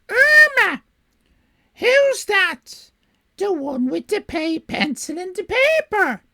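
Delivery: very high, loud shrieked name; squawky female cry. Acted. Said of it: "my generic squawky female voice".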